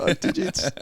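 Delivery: high-pitched laugh through words. Real, not acted. Repeats it, laughing.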